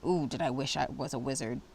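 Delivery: ordinary delivery, nothing marked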